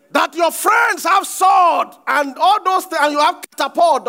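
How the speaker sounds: full-force preaching. Acted. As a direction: thunder it at the congregation.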